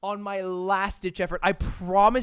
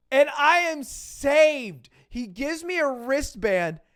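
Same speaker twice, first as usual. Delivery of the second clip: higher and louder, slow; triumphant; 7 semitones higher, 5 dB louder, slower than usual. Real, not acted. Teller declares his rescue to the audience, slow triumphant. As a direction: announce your rescue grandly.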